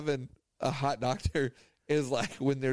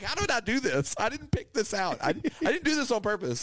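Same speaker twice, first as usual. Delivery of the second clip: excited; 7 semitones higher than usual. Retold or imitated. Incredulous about hot doctor.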